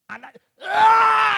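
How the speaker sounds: long high piercing yell of agony, held out slowly; retold scream of pain. Electrocuted preacher reliving it for the congregation, piercing shriek. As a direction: shriek out the shock of the current. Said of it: "and I screamed".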